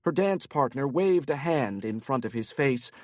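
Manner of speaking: ordinary delivery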